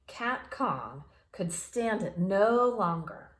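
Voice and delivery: usual speaking voice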